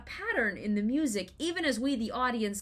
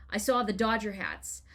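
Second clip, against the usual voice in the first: ordinary talk, nothing marked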